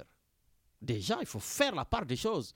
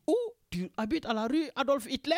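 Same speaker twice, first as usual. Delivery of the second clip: mishearing listener; 9 semitones higher than usual. Retold or imitated. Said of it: "Ils me disent".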